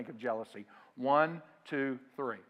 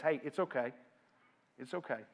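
softly calming, dropping quieter on the repeat; gentle reassurance; 6 dB quieter than usual. Acted. John to his worried disciples, gentle reassurance. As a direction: settle them down with a hushed word.

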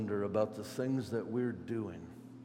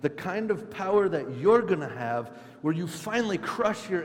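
raised, excited voice easing toward the end; eager disciples; 5 semitones higher, 4 dB louder than usual. Acted. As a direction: plead keenly as the hopeful disciples. Disciples keep pleading for power, acted out.

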